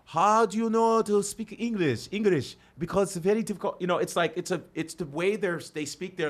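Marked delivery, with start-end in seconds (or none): 0.1-3.8 put-on Korean-accented English, slightly raised. Learner puzzling over English, Korean accent. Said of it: "if you're a Korean".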